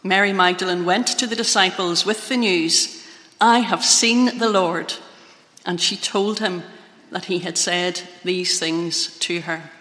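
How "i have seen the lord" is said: usual speaking voice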